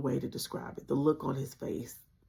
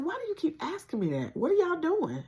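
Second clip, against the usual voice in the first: much higher, sing-song, a bit louder; forced playful; 3 dB louder than usual. Retold or imitated. Deflecting her mom's questions, high playful sing-song. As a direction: brush off mom's worry lightly. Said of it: "the most playful voice I could muster".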